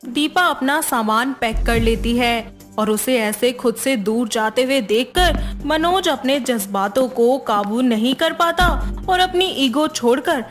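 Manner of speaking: ordinary delivery, nothing marked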